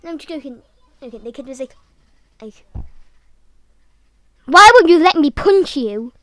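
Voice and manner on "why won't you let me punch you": loud, higher-pitched angry yell; explosive mock shout; yelling kid. Acted angry kid yelling at narrator.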